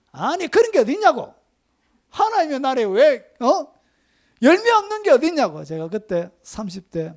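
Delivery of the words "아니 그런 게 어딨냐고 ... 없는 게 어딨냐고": high, loud and indignant; indignant protest. Retold or imitated. Young pastor protesting the deacons' warning, loud and indignant. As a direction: fire it back in disbelief.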